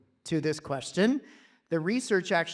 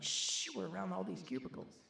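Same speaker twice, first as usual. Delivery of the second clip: hushing; 11 dB quieter than usual. Retold or imitated. Hushing him among office cubicles.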